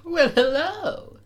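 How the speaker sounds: very high, drawn-out falsetto greeting; put-on character voice. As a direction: stretch it out slowly in a high affected voice. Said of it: "gotta get into this voice".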